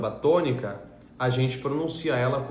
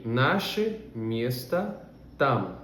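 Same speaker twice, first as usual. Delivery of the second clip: slow model reading; 3 dB quieter, much slower than usual. Shown as a demonstration. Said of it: "O nosso lugar é ali".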